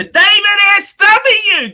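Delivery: loud, frustrated outburst; fed-up yell at a viewer; exasperated shout. Real, not acted. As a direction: bellow the advice in disbelief. Ranting advice to viewer.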